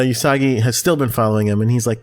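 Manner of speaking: ordinary talk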